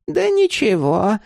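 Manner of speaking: high, slow crone voice; old woman's brush-off. A narrator's reading. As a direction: brush it off airily, pitched well up. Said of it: "ответила она".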